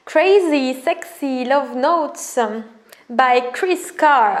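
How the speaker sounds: slow, each word laid out; slow title read. Shown as a demonstration. Vlogger showing her oracle deck, reading the title slowly. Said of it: "reading an oracle title".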